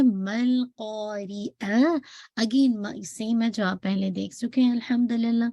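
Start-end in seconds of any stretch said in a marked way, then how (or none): 0.0-2.0 قاریعہ with a light ک; slow recited قاریعہ, kaf kept light. Shown as a demonstration. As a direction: recitation demo. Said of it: "کا کو آپ نے light رکھنا ہے".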